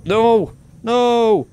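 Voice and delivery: high, loud wail of dismay; dismayed cry. Real, not acted. Player losing the med kit, crying out dismayed.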